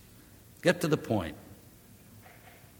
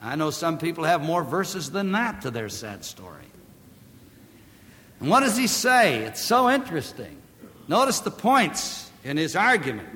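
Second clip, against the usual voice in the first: ordinary talk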